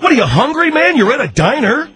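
high incredulous outburst; can't believe he's still eating; exasperated. Acted.

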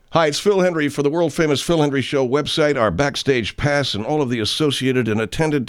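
upbeat announcer read; promo read. Acted.

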